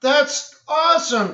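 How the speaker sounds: high, loud burst of delight; excited reaction. Retold or imitated. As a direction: act out being thrilled by the sight. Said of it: "and I'm like".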